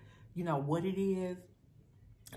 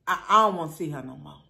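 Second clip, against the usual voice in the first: loud and fast, daring them; loud warning; 12 dB louder, faster than usual. Real, not acted. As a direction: blurt the threat out loud and quick. Warning about sixth-grade Bobby.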